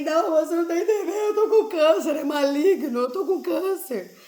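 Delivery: high, loud, half-crying half-laughing; sobbing laugh of despair, voice cracking high; tearful desperate protest. Retold or imitated. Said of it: "assim, chorando, desesperada".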